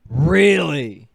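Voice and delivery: loud, slow disbelief; surprised. Real, not acted. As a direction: react like it's stunning news. Shocked reaction to news.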